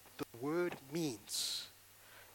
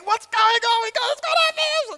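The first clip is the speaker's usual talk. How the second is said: high, loud mock rant; hammed-up furious boss; berserk rant; 16 dB louder than usual. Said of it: "going berserk".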